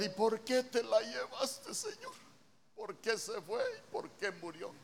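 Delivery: high, slow, wailing complaint to God; imitating a grieving believer's petulant why; mock whining. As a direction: whine like someone throwing a tantrum at God. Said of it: "no me voy a poner a patalear".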